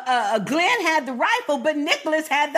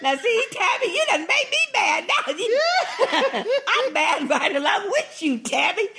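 laughing mock anger; 5 semitones higher than usual. Real, not acted.

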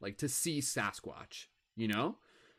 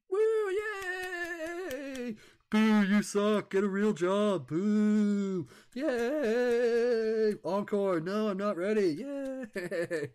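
higher, louder cheering and booing crowd voices; mock crowd; 7 semitones higher, 3 dB louder, much slower than usual. Comic after his set, voicing his own crowd. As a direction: play the whole crowd cheering and jeering.

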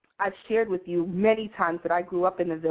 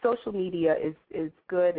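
usual speaking voice

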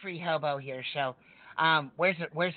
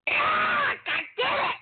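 much higher, loud yell of pain; angry shout; 4 dB louder, slower than usual. Acted. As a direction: yell it out, outraged. Honked nose, furious outburst.

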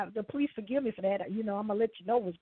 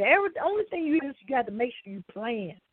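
normal talking voice

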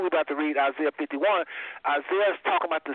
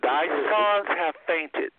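slow, drawn-out scripture reading; much slower than usual. Shown as a demonstration. Teacher rereading a verse for the listeners, slowly. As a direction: read it out slowly and deliberately. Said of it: "I'm gonna read that again slow".

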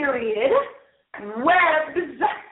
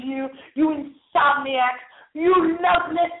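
ordinary talk

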